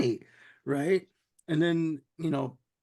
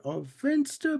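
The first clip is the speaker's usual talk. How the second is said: much higher, sing-song coo on "Finster"; high doting coo; 9 semitones higher, slower than usual. Retold or imitated. Bugs coos at Finster again, higher mock baby talk. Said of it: "he goes into the routine like".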